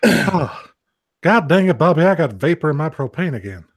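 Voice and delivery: exasperated Texan cartoon dad; Hank Hill. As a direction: grumble like a propane salesman. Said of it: "sort of do Hank Hill".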